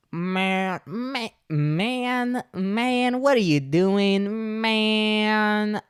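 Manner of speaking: high, long drawn-out words; trailer-park kid stretching every vowel up high. Acted. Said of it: "I think trailer park".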